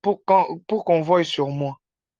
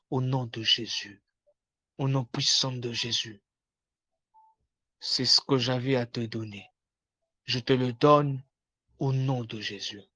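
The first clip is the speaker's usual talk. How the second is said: soft, low closing prayer; hushed prayer; 4 semitones lower, 6 dB quieter than usual. Acted. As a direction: seal the prayer quietly. (prière finale à voix basse)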